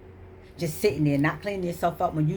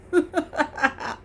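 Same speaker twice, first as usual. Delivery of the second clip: laugh; 10 semitones higher than usual. Real, not acted. Laughs at own mockery.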